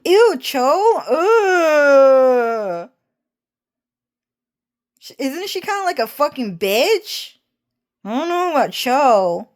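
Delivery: high, disgusted sneer; mean sister. Retold or imitated. Cho voicing his mean sister sneering at him.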